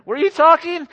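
high, loud, overly innocent "Were you talking?"; mock innocent husband. Pastor jokes how husbands dodge their wives, high and innocent. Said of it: "That's not the right one".

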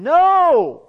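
high, booming drawn-out shout; loud, held cry of protest; emphatic refusal. Acted. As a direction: shout it out in sharp rebuttal. Emphatic rebuttal of imagined objection.

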